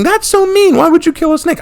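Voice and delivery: high whiny voice of a shocked viewer; mock offended viewer. Imagined viewer scolding the snake killer, high whiny mock. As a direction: parody someone scolding you. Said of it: "some of you might be thinking".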